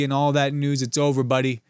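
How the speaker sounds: normal talking voice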